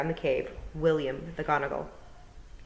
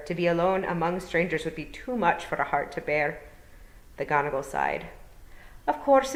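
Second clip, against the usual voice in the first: gentle Scottish burr, rolled r's; Scottish old Feagle; 3 semitones higher, 4 dB louder than usual. Acted. William explaining to Tiffany, courteous Scottish burr. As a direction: speak as a courteous Scottish elder.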